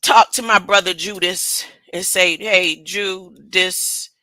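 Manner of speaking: tender Jesus forgiving Judas; Jesus gently. Retold or imitated. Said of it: "He said".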